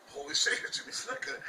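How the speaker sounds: high breathless starstruck whisper; starstruck gasp. Retold or imitated. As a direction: gasp it like meeting your hero. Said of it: "And we're like".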